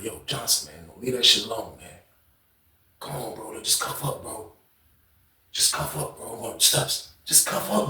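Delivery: very soft, near-whispered coaxing; hushed pleading. Retold or imitated. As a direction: beg a friend under your breath. Pleading quietly with cellmate Johnson.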